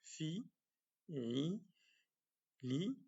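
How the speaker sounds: fi, ri, li said quietly; kasra syllables, soft. Shown as a demonstration. Teacher softly sounding kasra syllables. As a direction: sound out "fi ri li" gently. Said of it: "et la kasra".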